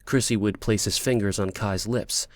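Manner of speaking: plain reading voice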